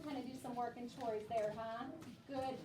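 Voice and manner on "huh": ordinary delivery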